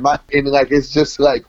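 ordinary talk, nothing marked

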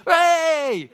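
loud, high cheer. Acted. Parent to a toddler learning to walk, cheering. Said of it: "claps and cheers".

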